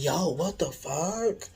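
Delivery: drawn-out, slow mock shock; stunned disbelief. Storyteller re-enacts reacting to the big doll, slowly incredulous. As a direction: stretch each word in retold disbelief.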